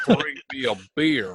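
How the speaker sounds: put-on Renasmir failing at a non-Renasmir accent, half laughing; a laughing, badly faked non-Renasmir accent; mock non-Renasmir accent. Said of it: "trying to do a non-Renasmir accent".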